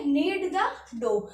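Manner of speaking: slow, careful repeat; slow model phrase; slow teaching repeat. Shown as a demonstration. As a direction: drill it slowly for the class. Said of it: "उसे हम बोलते हैं knead the dough".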